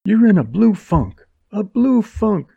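high, loud scornful sneer; contemptuous sneer. Acted. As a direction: curl the lip at his cowardice. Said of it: "Her upper lip curled".

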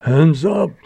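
loud, high sudden command; called out. A narrator's reading. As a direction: call it out sharply as a hold-up. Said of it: "called a voice".

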